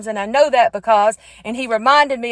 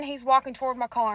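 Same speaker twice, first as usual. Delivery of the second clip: ordinary talk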